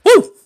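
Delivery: short, loud, high-pitched "woo"; celebratory whoop. Real, not acted. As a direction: let out a sudden victory whoop. Cheering the victory over death.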